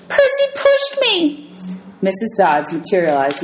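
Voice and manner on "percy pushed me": high, slow shrieking wail; screaming accusation. Acted. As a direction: shriek it out as she sits in the fountain.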